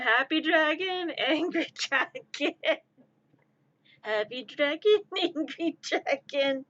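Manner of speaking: amused labels broken up by giggles; tickled, giggly commentary; giggling. Real, not acted. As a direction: laugh between the names. Giggling over happy, angry dragon.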